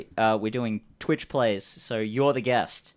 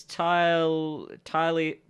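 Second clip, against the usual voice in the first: higher-pitched, halting character voice; bluffing stranger; 5 semitones higher, 4 dB louder, slower than usual. Acted. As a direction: stumble through a made-up story. Game master voices improvising stranger.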